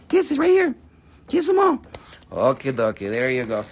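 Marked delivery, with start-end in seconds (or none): 0.1-1.8 high pet voice. Real, not acted. Host coaxes the dog to kiss the camera, high baby talk. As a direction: squeak it sweetly at the pup.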